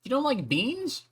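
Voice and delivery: high incredulous squeal; mock shock. Real, not acted. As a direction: act scandalized. Mock offended at chat.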